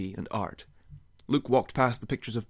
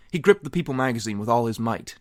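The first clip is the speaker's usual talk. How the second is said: ordinary delivery, nothing marked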